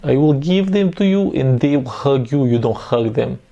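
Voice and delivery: put-on slow Russian accent with heavy h; mock-menacing Russian, throaty on each h. Commentator copying the fluffy rabbits bit, slow menacing Russian accent. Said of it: "It's very important to get the h properly".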